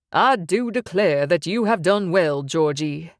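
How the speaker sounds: a Southern gentleman's slow, courtly drawl; lightly voiced Southern boss drawl; deep southern drawl. A narrator's reading. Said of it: "the man said in a deep southern drawl".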